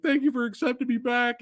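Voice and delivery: mock-emotional thanks, pitched high; lightly hammed-up tearful gratitude; Shane McMahon. Host imitating Shane thanking the crowd, mock emotional. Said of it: "tries to give this emotional".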